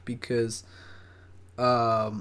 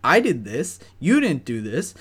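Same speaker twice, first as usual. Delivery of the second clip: loud, raised-pitch angry rant; retold flipping out; 7 semitones higher, 6 dB louder than usual. Flipping out at coworker before boss, loud retold anger. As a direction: fire accusations, hitting each point hard. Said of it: "I just flipped out".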